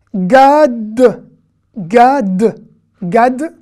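Gad said loud and clear, short a; model pronunciation. Shown as a demonstration. Teacher drilling Gad aloud for students. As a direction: call out Gad three times for the class to repeat. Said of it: "teacher models the word".

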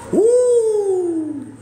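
very high loud whoop; woo yell. Real, not acted. Cowboy host whooping to hype viewers. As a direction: holler it out.

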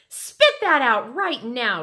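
loud, high, sharp order; urgent scolding. A narrator's reading. Sheila tells Mitzi to spit out dirt, urgent scolding. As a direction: order a child to stop at once.

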